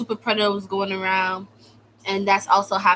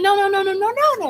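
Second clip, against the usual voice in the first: much higher, loud, frantic no; desperate pleading; 12 semitones higher, 5 dB louder, faster than usual. Retold or imitated. Girl begging the guards again, desperate and high. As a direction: plead like it's life or death.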